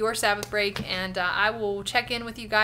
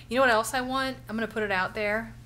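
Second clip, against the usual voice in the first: ordinary talk, nothing marked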